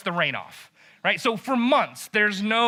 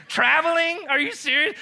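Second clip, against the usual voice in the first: high, rising mock disbelief; incredulous; 7 semitones higher than usual. Preacher reacts to Elijah's taunt, incredulous. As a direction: question it as if it's absurd. Preacher mocks the listed excuse.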